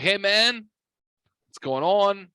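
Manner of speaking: high, bright phone hello to a buddy; upbeat greeting. Retold or imitated. Greeting friend on FaceTime.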